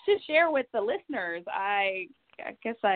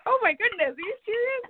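surprised; 13 semitones higher than usual. Retold or imitated. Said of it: "it's like".

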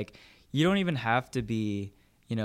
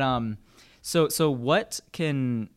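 usual speaking voice

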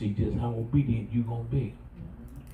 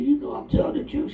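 playful teasing. Retold or imitated. Father teasing daughter.

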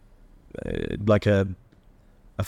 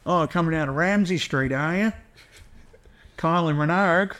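higher-pitched, hammed-up Aussie; exaggerated Australian soap caricature; 6 semitones higher than usual. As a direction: lay on a broad mock Australian accent. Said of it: "the Australian that they associate with Australians".

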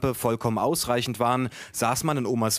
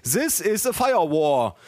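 put-on slow, higher-pitched teaching in German-accented English; 5 semitones higher, slower than usual. A narrator's reading. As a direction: spell it out slowly with a German accent. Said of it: "sagte er". (langsames Englisch mit deutschem Akzent)

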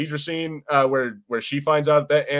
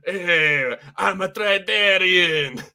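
higher, slow, put-on alien voice; mock alien accent; 6 semitones higher, 3 dB louder, much slower than usual. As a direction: ham up a Star Wars alien demanding money.

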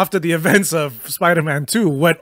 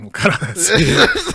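laughing repeat; slower than usual. Retold or imitated. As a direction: say it again, laughing.

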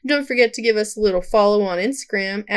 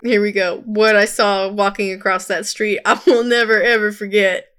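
usual speaking voice

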